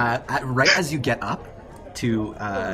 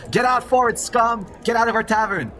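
much higher, louder jeering shout; crowd shouting; 13 semitones higher, 8 dB louder than usual. Acted. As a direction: drive the foreigners out. Tavern crowd shouts them out.

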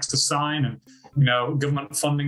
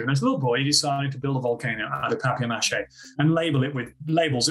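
ordinary talk

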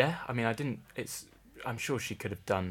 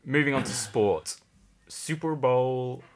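presenter voice; 4 semitones higher, 5 dB louder, slower than usual. Acted. Announcing next topic, presenter voice.

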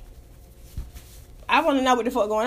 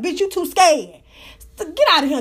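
ordinary delivery

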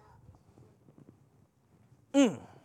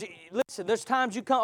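normal talking voice

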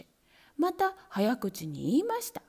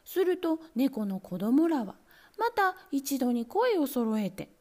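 usual speaking voice

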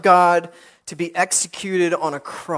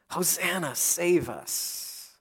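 much softer, trailing plea; hushed cry for help; 11 dB quieter, slower than usual. Acted. Preacher asks listeners if they have cried so, hushed plea. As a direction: let the cry sink to a quiet, slow plea. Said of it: "Have you ever cried out in this way?".

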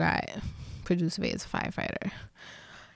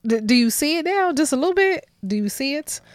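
louder, higher needling question; teasing loud question; 7 semitones higher, 7 dB louder than usual. Real, not acted. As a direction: needle him playfully. Teasing boyfriend at her side.

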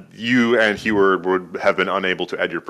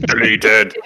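Mexican wrestler voice; 4 dB louder than usual. Pretend. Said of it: "in your very best Mexican wrestler voice".

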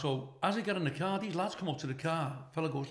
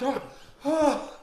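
high, loud panicked "oh"; mock panic, raised voice; retold panicked scream; 10 semitones higher, 6 dB louder than usual. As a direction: re-enact a frantic yelp. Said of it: "I'm screaming".

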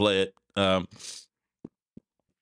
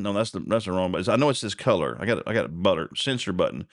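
normal talking voice

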